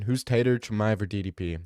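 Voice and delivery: usual speaking voice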